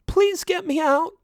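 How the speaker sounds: very high, tearful plea; a little crying. A narrator's reading. Stuck sheep pleading with her rescuer, lightly crying. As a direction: beg to be freed, weepy. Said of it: "still crying a little".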